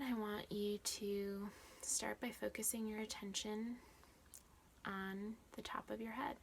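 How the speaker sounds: measured body-scan guidance with long silences; guided meditation. Real, not acted. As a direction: guide attention gently, leaving room after each instruction.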